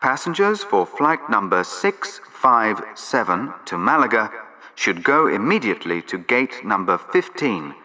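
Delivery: ordinary talk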